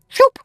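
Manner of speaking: sudden high, loud shoop; quick high-pitched whoosh noise; whooshing swerve. Retold or imitated. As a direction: imitate the tornado veering off. Tornado swerving past house.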